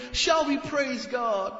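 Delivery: hammed-up stage host asking the crowd; mock worship leader. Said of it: "Worship leader".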